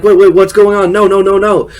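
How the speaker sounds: high, loud, rushed panic; mock freak-out, voice jumps up and loud; panicked protest. John Heder panicking at being put in the match, high loud mock panic.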